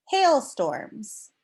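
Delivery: ordinary talk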